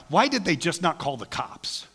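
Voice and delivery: usual speaking voice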